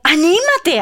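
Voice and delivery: high, loud cheer; urging encouragement. Acted. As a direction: cheer him on brightly and loudly. Crowd cheers on the blind man. (animándolo con voz alta y aguda)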